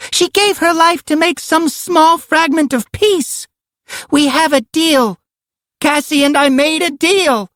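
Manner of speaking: put-on very high, desperate child's cry. A narrator's reading. Karen pleads with her attackers, crying out. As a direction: cry out in a piercingly high little-girl voice. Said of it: "Karen cried".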